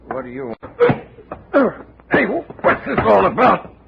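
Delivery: high, loud panicked yelling, drawn out at first; startled bartender shouting to be let go; frightened, rising to a shout; alarmed protest. Acted. Bartender grabbed by Johnny.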